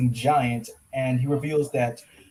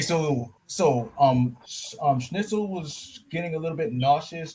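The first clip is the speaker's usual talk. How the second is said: normal talking voice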